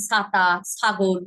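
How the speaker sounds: ছাতা and ছাগল starting with an s sound; regional pronunciation. Shown as a demonstration. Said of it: "আঞ্চলিক ভাষা". (আঞ্চলিক টানে ছ-কে স)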